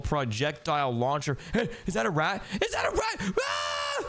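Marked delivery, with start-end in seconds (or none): 2.6-3.3 much higher, shouted alarm. Acted. Spotting a rat in the vents, shouting in alarm. 3.4-4.0 very high frightened scream; high shriek. Acted. Startled by a rat in the vents, shrieking.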